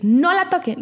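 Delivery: imitating her insisting again; insistent warning. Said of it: "volví a insistir".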